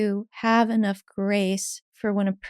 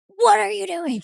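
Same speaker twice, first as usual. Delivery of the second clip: high, sharp incredulous cry; mock exasperation; 11 semitones higher than usual. Inner part reacting to a needy person, high. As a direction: snap at a clueless person. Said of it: "my parts that are like".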